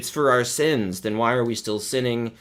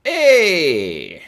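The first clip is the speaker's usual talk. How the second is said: high, loud cheery Hey; loud greeting; 7 semitones higher, 7 dB louder than usual. Pretend. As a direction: call it out like a sitcom neighbor. Greeting in a put-on voice.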